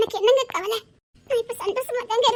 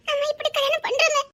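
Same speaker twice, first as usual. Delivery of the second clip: tearful, higher-pitched lament; weepy bride's voice, pitched up; crying; 5 semitones higher than usual. Acted. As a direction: lament the lonely wedding through tears. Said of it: "அழாத".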